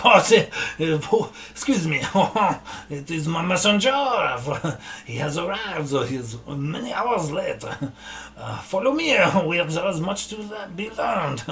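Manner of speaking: covering an awkward moment with a laughing, put-on dignity; Valinor flustered. Valinor excusing the fleeing dwarf to the party, flustered and laughing. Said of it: "And Valinor is like".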